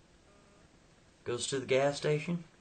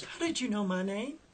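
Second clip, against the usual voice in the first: startled; 10 semitones higher than usual. Retold or imitated. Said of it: "She goes".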